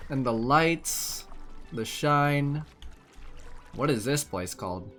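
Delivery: usual speaking voice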